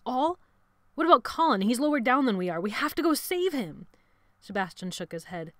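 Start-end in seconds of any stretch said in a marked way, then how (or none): 0.0-3.9 loud, high, alarmed pleading; urgent protest. A narrator's reading. Jonathan demanding they save Colin, urgent protest. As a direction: argue urgently for a rescue, voice raised. Boy urges rescue of trapped friend.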